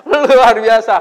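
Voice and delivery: loud, high laughing exclamation. Real, not acted. Preacher laughing to the congregation about the elopement, loud. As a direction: laugh it out in amazement.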